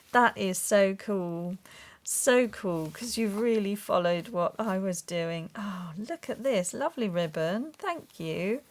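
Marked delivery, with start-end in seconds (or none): none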